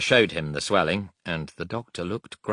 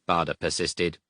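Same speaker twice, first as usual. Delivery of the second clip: ordinary delivery, nothing marked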